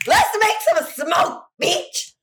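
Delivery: high, slow triumphant holler; rally the girls with a whoop; battle cry. Acted.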